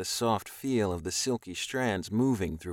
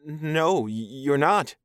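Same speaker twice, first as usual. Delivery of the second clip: high, loud, rattled stammer; nervous stammer; 6 semitones higher, 6 dB louder, slower than usual. A narrator's reading. Captive man agreeing with alien, nervous stammer. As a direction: stumble over it, pitched up.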